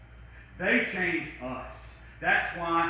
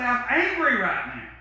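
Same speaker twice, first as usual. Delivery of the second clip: 1 admitting anger; 6 semitones higher, 5 dB louder than usual. Shown as a demonstration. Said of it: "Sometimes I say".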